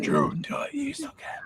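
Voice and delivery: hushed, breathy urging; whispering. Real, not acted. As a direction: whisper it so nobody hears. Urging someone secretly.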